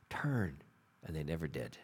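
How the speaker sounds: soft, low and spent; a hushed, low echo of the plea; faded, defeated. Acted. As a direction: let it die away in sorrow. Fading after prophet's plea.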